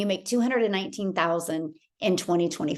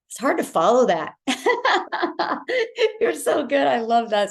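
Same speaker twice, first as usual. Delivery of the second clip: bursting into high laughter; delighted laugh praising her colleague; 4 semitones higher, 3 dB louder than usual. Real, not acted. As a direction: laugh it out, then gush. Laughing after colleague's speech.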